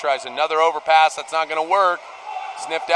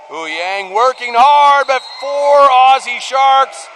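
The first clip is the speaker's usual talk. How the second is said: slower, higher and louder as the Sharks close in; building excitement; 4 semitones higher, 5 dB louder, slower than usual. Real, not acted. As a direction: build the tension toward the steal. Defense steals the ball live.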